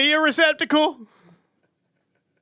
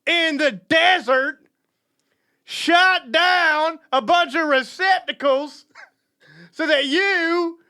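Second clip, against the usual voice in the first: slow, dragged-out war-story voice; hammed-up solemn soldier; slow mock solemn veteran; 3 semitones higher, slower than usual. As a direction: lean into each word like a grave veteran.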